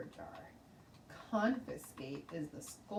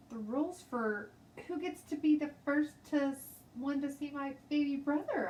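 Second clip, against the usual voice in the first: high, loud, eager rush; eager explaining; 9 semitones higher, 6 dB louder than usual. A narrator's reading. Girl explaining her rules to the teacher, eager. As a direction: blurt out the rule proudly. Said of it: "I said".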